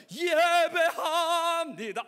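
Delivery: very loud, high, drawn-out cry; fervent worship. Acted. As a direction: stretch it out in ardent devotion.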